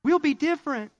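high, mock-dreamy young lover; high mock-innocent promise; naive young couple. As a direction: play the starry-eyed new dater.

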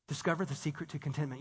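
low, hushed, quick headline; mock clickbait teaser. Preacher imitating a clickbait headline, hushed. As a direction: tease it softly like a big secret.

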